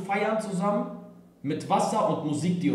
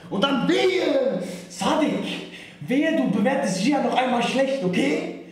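mock threat; 8 semitones higher, 3 dB louder than usual. Mock warning to a reviewer.

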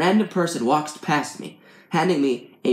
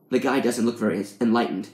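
ordinary delivery

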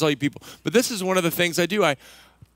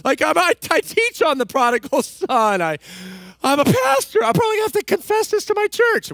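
much higher, louder, anguished self-scolding; mock self-reproach; 12 semitones higher, 6 dB louder than usual. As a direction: ham up your own guilt trip, high and loud. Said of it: "And then I'm like".

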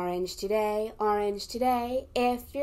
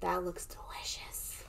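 whispered; 5 semitones lower, 13 dB quieter than usual. Acted. Hushed admiration.

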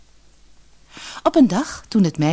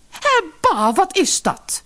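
high-pitched, appalled exclamation; disgusted; 14 semitones higher, slower than usual. A narrator's reading. Mother seeing toads fall from daughter's mouth, disgusted. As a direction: recoil in disgust with the voice raised.

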